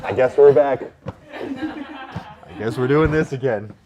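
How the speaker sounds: loud, slow laughing shout. Real, not acted. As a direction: belt it out laughing.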